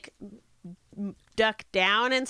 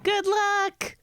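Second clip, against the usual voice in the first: high drawn-out "good luck", sing-song; 10 semitones higher than usual. Real, not acted. Wishing a fellow player good luck on the roll, high sing-song. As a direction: call it out high and slow, in a teasing lilt.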